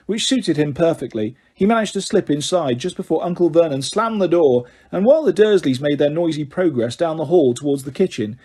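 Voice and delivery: ordinary delivery, nothing marked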